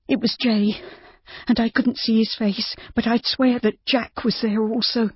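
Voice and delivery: high, steady confession; Judy, confessing. A narrator's reading. Judy admitting to Rob who she saw, high and steady. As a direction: get it out at last.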